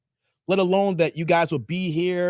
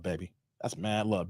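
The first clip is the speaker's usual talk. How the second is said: normal talking voice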